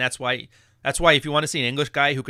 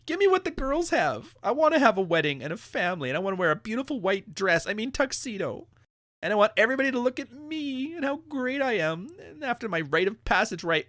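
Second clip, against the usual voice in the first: much higher pleading whine; mock entitled whine; 7 semitones higher than usual. Mocking men who want the bride's spotlight. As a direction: ham up a needy man-child.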